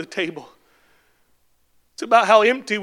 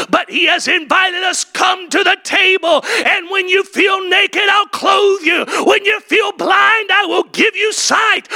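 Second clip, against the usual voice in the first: full-force preaching; 8 semitones higher than usual. Acted. Preacher crying out God's promise to the congregation. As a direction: build the call higher each line.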